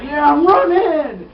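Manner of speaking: high, slow-motion shout; mock running yell. Knife guy flees across the island, mock yell. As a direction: stretch it out as a hammed-up yell.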